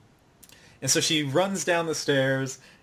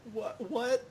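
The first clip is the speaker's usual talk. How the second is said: mock confusion; 10 semitones higher, 8 dB quieter than usual. Heroine's confused reply.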